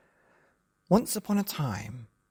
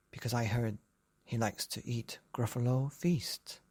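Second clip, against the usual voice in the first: scary whisper; 2 semitones lower, 6 dB quieter than usual. Acted. Child tells scary secret.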